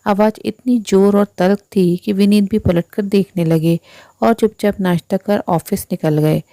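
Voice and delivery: usual speaking voice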